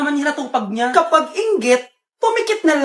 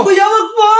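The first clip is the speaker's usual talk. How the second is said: frightened plea; 4 semitones higher, 4 dB louder than usual. Acted. Victim pleads with robber.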